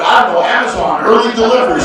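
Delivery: loud joking excuse; mock shouted excuse. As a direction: shout a silly excuse back to the wife. Excuse shouted back to wife.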